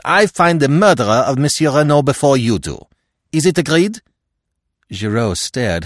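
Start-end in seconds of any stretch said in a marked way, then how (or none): 0.0-4.0 put-on high, loud, accented wager; Poirot's challenge. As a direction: throw down the challenge loudly as Poirot.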